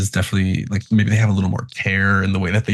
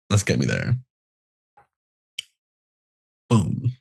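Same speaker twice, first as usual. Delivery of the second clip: talking through a bite of food; chewing while talking; 4 semitones lower, 4 dB quieter than usual. Real, not acted. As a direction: mouth full. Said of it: "Sorry, I'm chewing".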